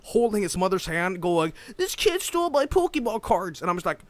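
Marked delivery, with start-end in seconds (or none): 1.8-3.6 high, mock-tearful whine; imitating a crying little boy; crying kid. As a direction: whine it like a tattling child.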